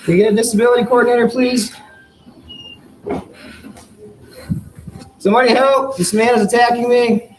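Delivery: high pleading shouts; desperate calls for help. Real, not acted. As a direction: call out for help in distress. Man crying for help on ground.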